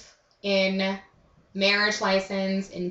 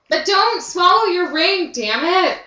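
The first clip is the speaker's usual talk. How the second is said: high, loud mock warning; exclaiming loudly; 10 semitones higher, 6 dB louder than usual. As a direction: shout it like a dramatic warning.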